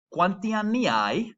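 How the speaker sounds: "Quanti anni hai?" slow, every syllable clear; slow careful Italian. Shown as a demonstration. Teacher modelling the phrase for the class, slow and clear. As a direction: spell out "Quanti anni hai?" slowly for learners. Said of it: "I'll say it again".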